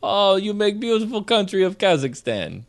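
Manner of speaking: put-on half-hearted Borat-style Kazakh accent; a hint of a Kazakh accent, playful; mock Kazakh accent. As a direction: toss off a lightly faked Kazakh accent. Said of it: "I don't know what accent to use".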